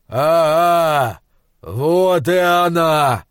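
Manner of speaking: slow, pleased giant voice; slow-witted giant finding something; dim giant. Acted. Giant finds his glove.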